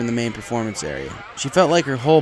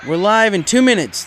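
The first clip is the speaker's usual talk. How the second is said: much higher and louder call; brisk backstage call-out; stage director; 9 semitones higher, 10 dB louder than usual. A narrator's reading. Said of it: "the stage director said".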